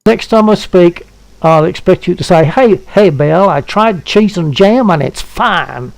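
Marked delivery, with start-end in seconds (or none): 2.5-5.9 mock American accent, drawn out; imitated American accent. Man mimicking his friend's reply about cheese and jam, mock American accent. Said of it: "that is not my accent".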